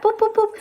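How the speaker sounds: playful high boops; tapping sounds. Shown as a demonstration. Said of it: "Just go like that".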